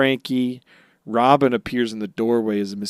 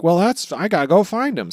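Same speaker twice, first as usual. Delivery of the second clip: higher, louder eager Luffy; 8 semitones higher, 6 dB louder than usual. Retold or imitated. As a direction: blurt it out like Luffy. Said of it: "So then Luffy's like".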